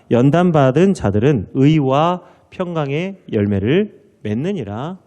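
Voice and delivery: loud, solemn Bible reading; scripture reading. Acted. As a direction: proclaim the scripture to the church.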